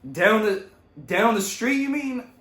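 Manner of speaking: high, loud mock cop question; cop asking. Cop in the car asks where he lives, high incredulous voice. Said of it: "And, like".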